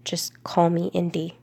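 ordinary talk, nothing marked

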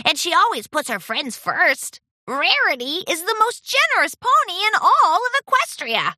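high, loud gushing little dragon; adoring Spike. A narrator's reading. Dragon praising his idol to her apprentice, gushing. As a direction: gush with big, high-pitched admiration.

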